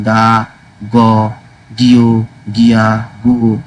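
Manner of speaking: slow drill of গা, গ, গিয়, গিয়া, গু; syllable pronunciation drill. Shown as a demonstration. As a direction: model each syllable slowly for the class. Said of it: "লিখে উচ্চারণ করবেন".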